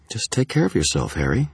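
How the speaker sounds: anxious woman's goodbye, lifting at the end; worried. A narrator's reading. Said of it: "she said, worry in her voice".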